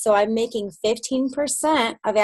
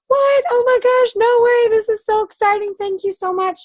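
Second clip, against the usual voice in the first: much higher, louder squealing disbelief; ecstatic reaction; 11 semitones higher, 5 dB louder than usual. Retold or imitated. Thanking her sponsor for a new distributor, in squealing delight. Her own retold thrilled reply.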